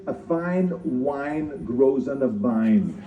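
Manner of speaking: very slowly spelling out every sound of "A fine wine grows on a vine"; slow accent drill. Shown as a demonstration. Practising accent removal, over-careful slow enunciation. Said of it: "I walked around the whole day saying".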